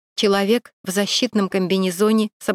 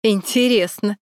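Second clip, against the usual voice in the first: mocking. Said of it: "незнакомый насмешливый голос".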